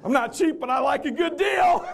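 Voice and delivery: high, loud, laughing; real laughter on the punchline. Real, not acted. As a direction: burst out laughing through it.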